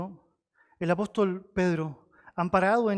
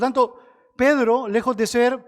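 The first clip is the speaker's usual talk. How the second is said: louder preaching; 5 semitones higher, 6 dB louder, slower than usual. Real, not acted. Preacher builds to loud point.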